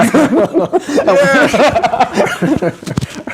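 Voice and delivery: long high burst of laughter. Real, not acted. Cohost laughs at his own accent slip, high laugh. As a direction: crack up.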